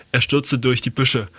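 ordinary delivery, nothing marked

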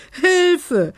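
high, loud panicked scream; a little witch's high, loud cry of panic; frightened cry for help. A narrator's reading. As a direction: shriek for help as the kitchen crashes. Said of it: "schrie Belinda".

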